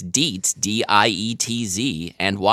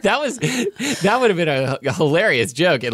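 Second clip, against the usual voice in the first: laughing; 7 semitones higher than usual. Real, not acted. Laughing at his own joke.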